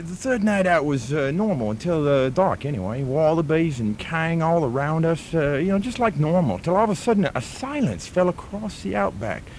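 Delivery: broad put-on Australian bloke spinning a yarn; Australian accent. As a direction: play an outback Australian storyteller.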